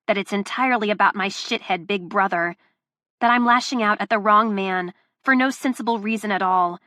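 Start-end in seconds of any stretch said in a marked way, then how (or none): none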